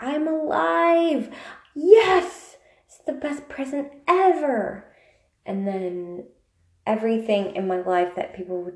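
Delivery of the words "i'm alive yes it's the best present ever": high, loud overjoyed cry; excited shout. Acted. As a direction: burst out thrilled like a gift. Skit bursting out of wrapping paper.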